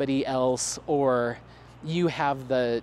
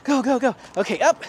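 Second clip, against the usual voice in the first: eager urging; 8 semitones higher, 4 dB louder, faster than usual. Real, not acted. Urging dog on course.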